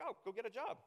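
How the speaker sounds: high, dismissive "go get a job"; a hint of a smug bystander; lightly mocking glib adviser. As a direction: brush the problem off, lightly mocking. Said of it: "people might just be like".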